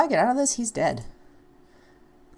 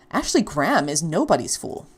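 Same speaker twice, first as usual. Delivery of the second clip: ordinary delivery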